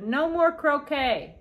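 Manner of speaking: loud, slow, high shout. Acted. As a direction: bellow it out, drawn out and fed up. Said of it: "Gus shouted".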